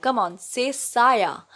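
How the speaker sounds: put-on very slow, spelling it out for a slow child; patient and slow. Teacher to a dim-witted pupil, slow and patient. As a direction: coax it out syllable by syllable. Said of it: "like she was dealing with the dim-witted child".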